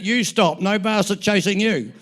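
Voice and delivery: loud comic comeback; yelled retort. Retold or imitated. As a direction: yell back in protest, for the laugh. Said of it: "apparently I yelled out".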